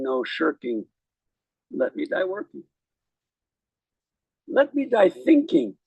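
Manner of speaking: slow, solemn recitation with rising emphasis; poem reading. Acted. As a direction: read the verse like a eulogy. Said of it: "I'll read a little poem".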